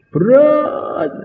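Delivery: high, strained gasp of heaviness; strained cry of burden. Acted. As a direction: let the weight crush the word. Feeling the burden of Badr. (seruan tertekan dengan nada sangat tinggi)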